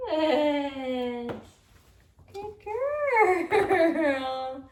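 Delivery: drawn-out, high and loud praise; excited praise. Real, not acted. Trainer rewarding the dog for holding still, excited. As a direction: gush over the dog.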